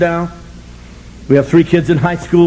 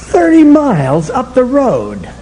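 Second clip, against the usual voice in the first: eager reply; 8 semitones higher, 4 dB louder, slower than usual. Retold or imitated. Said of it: "I said".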